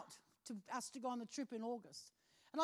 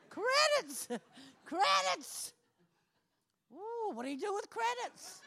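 much higher, louder "Credits?" with laughter; excited disbelief; 8 semitones higher, 4 dB louder, slower than usual. Retold or imitated. Woman thrilled by the cruise offer, laughing high. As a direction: squeal the word "Credits?" in delighted shock.

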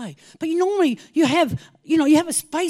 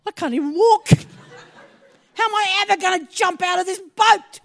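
raised, anxious self-talk; worried thought; 6 semitones higher, 8 dB louder than usual. Retold or imitated. Recalling her fear of the boat jump, raised and anxious. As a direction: fret out loud about jumping. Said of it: "I thought".

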